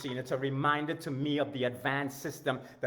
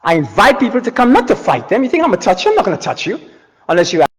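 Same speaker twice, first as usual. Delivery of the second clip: much louder and higher, insisting; loud heated rant; 5 semitones higher, 19 dB louder, faster than usual. Real, not acted. Coach to online critics, loudly insisting. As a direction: push it out hard to the doubters.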